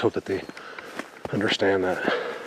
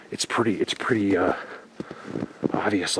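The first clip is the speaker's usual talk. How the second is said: ordinary talk, nothing marked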